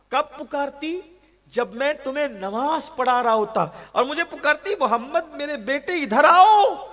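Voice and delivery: tearful sermon climax, voice breaking; weeping climax. Acted. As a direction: break into a crying wail.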